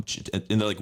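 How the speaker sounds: ordinary talk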